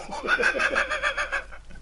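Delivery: shrieking giggle that climbs very high; high-pitched laugh. Real, not acted. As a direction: burst into a squealing laugh. Laughing at his own jab at William.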